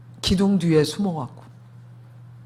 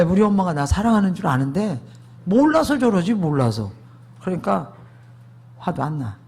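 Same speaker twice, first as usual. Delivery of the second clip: usual speaking voice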